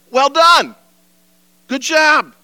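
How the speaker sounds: Jesus beaming his approval; Jesus praising. Acted. Preacher voices Jesus praising church.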